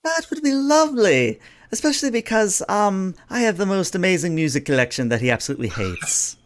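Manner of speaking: high, put-on princess voice; delighted princess. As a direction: accept with relish, starting high and easing down. Princess replies to the Commodore.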